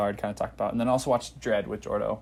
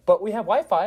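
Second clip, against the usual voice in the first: cheery robot pitch; 7 semitones higher, 5 dB louder than usual. Retold or imitated. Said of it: "she's like".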